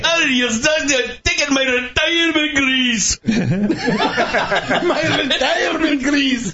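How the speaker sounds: hammed-up Scottish grumble, cracking up; Groundskeeper Willie. Co-host keeps the Groundskeeper Willie bit going with a laughing Scottish brogue. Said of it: "Groundskeeper Willie".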